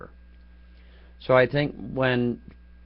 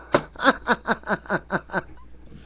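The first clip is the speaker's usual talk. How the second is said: breathy silent laugh; 8 semitones higher than usual. Real, not acted. Laughs at his own story.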